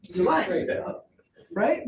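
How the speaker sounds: ordinary talk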